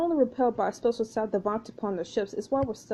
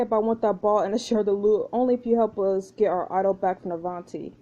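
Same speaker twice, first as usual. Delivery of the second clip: ordinary talk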